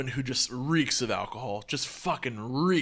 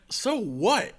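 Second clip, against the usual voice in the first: raised, louder dismissive challenge; defiant retort; 9 semitones higher, 5 dB louder than usual. Retold or imitated. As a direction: throw it back at the angry neighbor. Retelling his retort in the quarrel.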